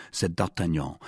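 ordinary delivery

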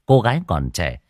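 ordinary delivery, nothing marked

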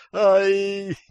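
high drawn-out "ay"; pained yelp. Acted. Captive hit again in the liver, high yelp. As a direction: cry out from the blow.

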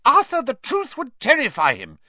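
high, loud alarmed protest; piercing, anxious wife; shrill. A narrator's reading. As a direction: shrilly plead with husband. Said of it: "said Missus Weasley shrilly".

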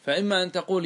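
ordinary delivery

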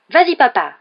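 high, loud cry of encouragement; cheering shout. A narrator's reading. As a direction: egg him on at the top of your voice. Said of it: "s'écria Fred et George". (cri d'encouragement)

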